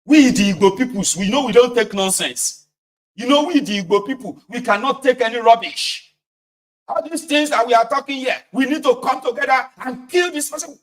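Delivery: loud, high mock rabble-rousing; indignant agitator. Acting agitator jumping in.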